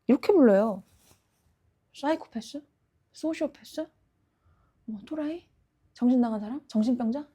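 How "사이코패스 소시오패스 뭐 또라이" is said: quiet taunt. Acted. Killer lists names for himself.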